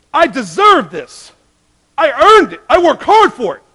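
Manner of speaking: loud, high, grasping demands; greedy shout. Retold or imitated. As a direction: shout out the greedy wants like a spoiled child. Quoting greedy self, shouted.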